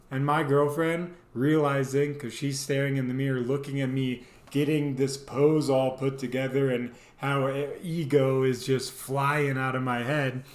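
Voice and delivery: usual speaking voice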